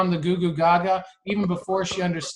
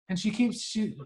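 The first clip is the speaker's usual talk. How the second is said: ordinary talk, nothing marked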